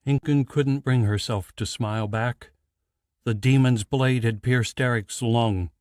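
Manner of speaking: plain reading voice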